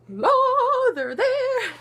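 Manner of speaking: high squeal of delight; playful surprised squeal with a laugh; mock delighted gasp. Mock excited cheek reaction.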